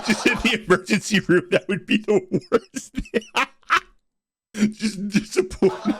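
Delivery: high words squeezed through laughter; real helpless laughter. Real, not acted. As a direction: talk while cracking up. Reactor laughs at the dad line.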